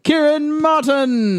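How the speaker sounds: high, slow, drawn-out fight announcer; ring announcer. Acted. Introducing his friend like a boxer, slow high announcer call. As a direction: boom the name like a boxing MC.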